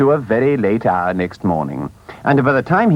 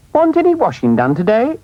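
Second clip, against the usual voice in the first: high, meek washerwoman voice; lightly voiced humble washerwoman; 10 semitones higher than usual. A narrator's reading. Mole disguised, greeting the sentries, lightly voiced washerwoman. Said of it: "very respectful".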